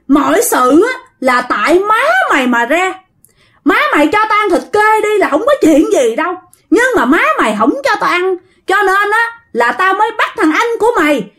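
high-pitched, accusing scolding; possessed man berating a crying child; scolding threat. A narrator's reading. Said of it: "Chửi vậy đó các bạn".

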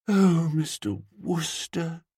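high, slow, swooning; emotional dreamy woman. A narrator's reading. Woman moved after watching the duck, swooning. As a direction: draw the name out, deeply moved. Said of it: "from the tone of her voice".

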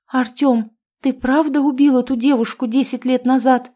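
narrated tremulous plea; trembling voice. A narrator's reading. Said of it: "спросила она дрожащим голосом".

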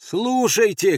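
high, loud call for attention; shout. Acted. Andres trying to make the sentries listen, shouting. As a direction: cry out to be heard. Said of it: "крикнул Андрес".

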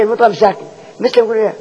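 usual speaking voice

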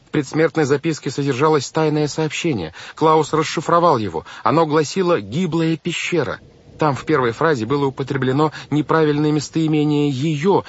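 high-pitched urgent explaining; earnest, insistent girl. A narrator's reading. Girl explains the secret note to banker, insistent.

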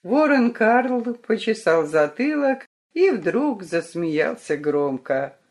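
ordinary delivery, nothing marked

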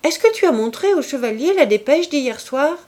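high, loud calling question. Acted. Clorinde calling loudly to her mother about the dispatch. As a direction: call the question across the gallery.

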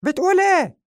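very high, loud squeaky question; cat voice. Acted. The cat asking Jackie Chan, very high and loud squeak. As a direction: squeak it in disbelief like a cartoon cat. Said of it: "نظر له القط وهو يقول".

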